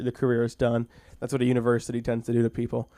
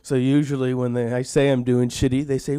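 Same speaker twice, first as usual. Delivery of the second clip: ordinary delivery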